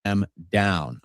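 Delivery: usual speaking voice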